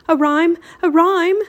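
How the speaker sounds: high, loud burst of urgency; urgent cry. Acted. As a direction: call for it urgently, twice over. Teddy urgently needs a rhyme.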